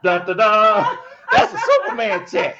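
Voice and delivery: loud, high fanfare announcement; Coach Ronnie impersonation. Acted. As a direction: belt out a stiff, hammed-up superhero announcement. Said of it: "that was my Coach Ronnie impersonation".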